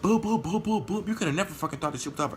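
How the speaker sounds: gleeful punching taunt. Retold or imitated. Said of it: "I'm like".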